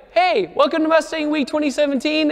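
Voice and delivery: high, bright YouTube host opener; hyped video intro. Retold or imitated. Vlogger opening his event video, hyped intro voice. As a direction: kick off the video like a pumped-up host. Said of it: "Your intro".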